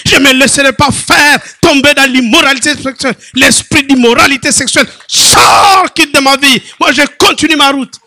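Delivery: loud chanted prayer over music; preaching at full force. Acted. Preacher resisting sexual immorality, shouted sung-out prayer.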